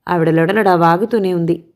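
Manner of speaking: plain reading voice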